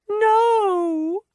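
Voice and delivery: very high, loud keening cry; a wife keening as she clings to him. A narrator's reading. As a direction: wail a desperate no. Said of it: "she keened".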